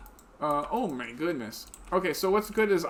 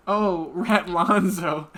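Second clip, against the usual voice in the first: grand naming; 7 dB louder, much slower than usual. Acted. Naming the pet rat grandly.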